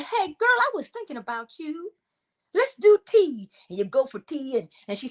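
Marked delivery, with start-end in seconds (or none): 0.0-3.5 put-on high-pitched, girlfriendly phone greeting; chirpy friend. Calling a friend who popped into your head, chirpy. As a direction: sing out a cheery invitation, pitched right up.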